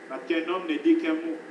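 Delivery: ordinary talk, nothing marked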